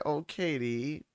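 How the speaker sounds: low, soft, tearful thanks; real choked-up gratitude, hushed; close to tears. Real, not acted. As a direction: hold back tears while giving quiet thanks. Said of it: "I made myself cry".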